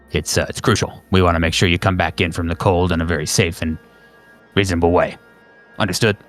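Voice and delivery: low-pitched handler giving instructions; agency phone operator. Acted. Operator gives caller instructions.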